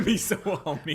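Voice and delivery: high, laughing squeaky voice; laughing high echo. Retold or imitated.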